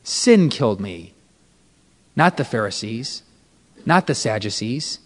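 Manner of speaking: very low, hushed correction; deep, quiet, measured reply; voice of Jesus. Retold or imitated. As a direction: answer softly and gravely, pausing between phrases. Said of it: "And he said".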